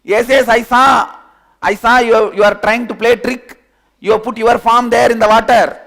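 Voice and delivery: high, loud insistent accusation; loud accusing. Acted. Arguing fellow loudly accuses the other of a trick. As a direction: accuse him hotly.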